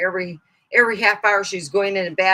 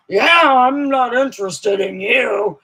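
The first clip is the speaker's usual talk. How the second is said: imitating sibling; 4 semitones higher, 7 dB louder than usual.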